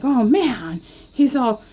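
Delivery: ordinary talk, nothing marked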